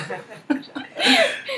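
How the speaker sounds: very high, squealing laugh; amused laugh. Real, not acted. Amused introducing an upcoming doctor.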